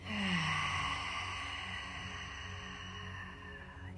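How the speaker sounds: long, soft sighing breath out; slow, airy meditative sigh; long exhale. Shown as a demonstration. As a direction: let the air out slowly through the mouth. Models deep meditation breathing.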